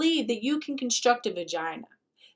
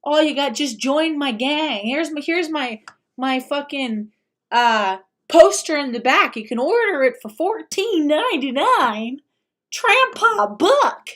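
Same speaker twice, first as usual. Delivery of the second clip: hammed-up hype pitch; 3 semitones higher, 7 dB louder than usual. Host mocks influencer recruiting kids, loud hammed-up pitch. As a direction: sell the cult poster like a carnival barker.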